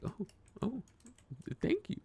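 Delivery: tiny, very high-pitched thank you; bashful thanks. Retold or imitated. Replaying own answer to a compliment, high and bashful. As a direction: squeak it out like a flustered receiver of praise.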